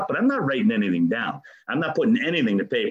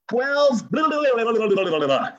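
louder, higher dismissive blah blah; mocking recital; 8 semitones higher, 3 dB louder than usual. Reading the wall steps aloud as dismissive, louder gibberish. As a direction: rattle off the steps with scorn.

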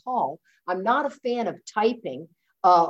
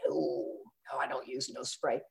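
low, soft imitation of a husband; understated, low-voiced fibbing; mock innocent denial; 6 semitones lower, 11 dB quieter than usual. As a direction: play down the question, quietly and low. Acting out husband's denial to nurse.

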